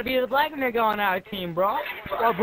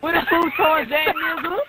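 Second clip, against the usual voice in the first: higher, louder put-on street voice; mock street voice; 6 semitones higher, 3 dB louder than usual. Taunting a teammate in a mocking put-on voice. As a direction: ham up a mocking caricature.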